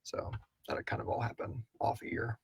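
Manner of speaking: hushed whisper to the side; whispered aside. Real, not acted. Hinting at future story, whispering aside to cohost. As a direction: drop to a secret whisper.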